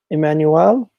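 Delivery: Emmanuel tried out with a rising, questioning end; careful pronunciation attempt. Shown as a demonstration. Streamer trying a viewer's correction, Emmanuel with a questioning rise. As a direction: say Emmanuel carefully, lifting the last syllable. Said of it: "It's just pronunciation sometimes hard".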